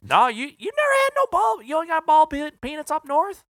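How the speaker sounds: squeaky, hammed-up Southern accent, amazed; mimicking the sales rep's Southern drawl in disbelief. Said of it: "he's got that southern drawl".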